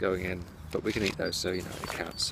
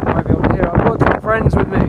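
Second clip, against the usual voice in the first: shouting over wind; 6 semitones higher, 9 dB louder than usual. Real, not acted. Windy allotment, talking over gusts.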